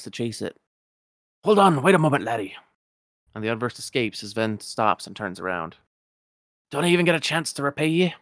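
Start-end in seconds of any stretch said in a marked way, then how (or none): 1.4-2.6 higher, louder Scottish miser voice; indignant old Scotsman, raised and rolling; Scrooge McDuck. Acted. 6.7-8.1 quick, higher Scottish miser voice; eager old Scotsman, rattled off fast; Scrooge McDuck. Acted.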